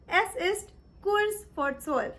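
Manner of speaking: careful, slow German; "drei vor zwölf" said slowly and clearly; slow German demo. Shown as a demonstration. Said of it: "Or you can say".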